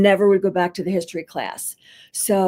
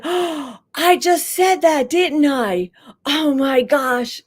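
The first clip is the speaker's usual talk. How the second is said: sudden realization; 6 semitones higher, slower than usual. Retold or imitated. Teacher realizes her own words.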